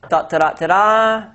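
playful sung-out drumroll building to a lift; mock drumroll. Lecturer teasing the class before the find, mock drumroll. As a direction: hum a little drumroll for suspense.

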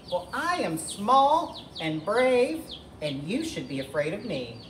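high little hen voice, dropping at the end; plucky chicken. Acted. Edna tells Tyrannosaurus to fear her, high plucky voice. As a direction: start high and stretch the brave boast. Small chicken answers the boast.

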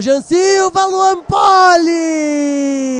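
high stretched shout; excited shout. Real, not acted. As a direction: yell the save. Save shouted.

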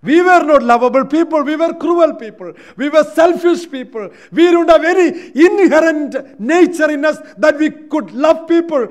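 loud, high, pressing sermon climax; full-force preaching. Acted. Preacher confessing to the church that we were selfish, at full force. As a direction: drive the point home at full volume.

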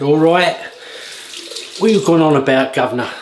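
a lightly done Cockney geezer; mock Cockney. Imitating London locals in Cockney. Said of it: "you'll hear people talking like".

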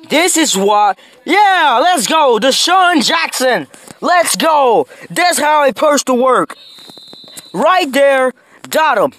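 high, thrilled celebration; excited cheering. Real, not acted. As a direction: cheer the play like it just won. Celebrating a touchdown pass.

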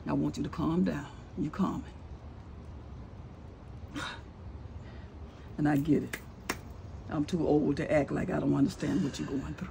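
low, soft and soothing; calming reassurance. Acted. Older woman calming her hurting daughter-in-law, gently reassuring. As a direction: bring it right down to settle her.

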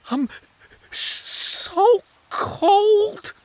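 high, stuttering with cold; shivering woodlouse. Acted. Woodlouse shivering under a branch, stammering with cold. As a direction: teeth chattering, squeaky little bug. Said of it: "said one".

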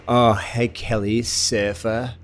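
lightly imitated bored, dismissive echo; dismissive brush-off. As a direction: toss the words back as if writing him off. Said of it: "writes me off".

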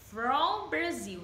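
slow and careful, leaning on "from" in "from Brazil"; stress on from. Shown as a demonstration. Teacher showing students that "from" comes before the country. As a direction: say "from" slowly and clearly before the country. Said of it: "from sempre vai vir antes de países".

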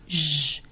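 ج as a low, isolated "zh" sound. Shown as a demonstration. Teacher isolating the sound in unusual, low held "zh" for ج. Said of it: "الاس مع اليو هنا اتنطقت ج".

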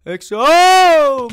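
high, loud, long-held cry; falling cry. Real, not acted. Gamer calls friend while falling, long loud cry. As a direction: wail it out as you fall.

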